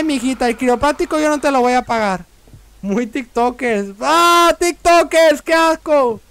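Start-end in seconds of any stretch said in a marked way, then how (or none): 2.8-3.9 slow, unexcited comment; drawn-out remark. Real, not acted. Player comments on the dancers, slow drawn-out remark. 4.0-6.2 high, loud cry of disgust; disgusted outcry. Pretend. Player recoils from the TikTokers, disgusted outcry.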